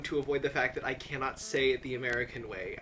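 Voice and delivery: ordinary talk, nothing marked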